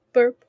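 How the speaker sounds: a loud real burp. Real, not acted. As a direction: let out a burp. Excuse me.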